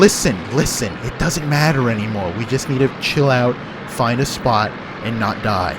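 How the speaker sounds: loud, firm orders over the noise; shouting. Acted. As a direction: shout to be heard over gunfire.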